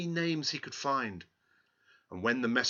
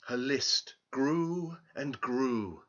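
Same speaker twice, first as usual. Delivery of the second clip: ordinary delivery, nothing marked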